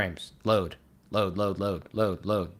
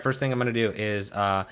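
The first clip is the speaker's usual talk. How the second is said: ordinary talk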